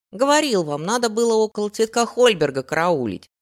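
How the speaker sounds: put-on loud, eager street boy; a cheeky kid insisting. A narrator's reading. Remi voiced as boy.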